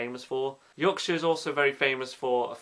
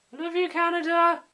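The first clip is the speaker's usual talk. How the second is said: much higher and louder shout-out; high bright call out to Canada; 5 dB louder than usual. Real, not acted. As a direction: gush a cheery shout to Canada. Calling out to Canada.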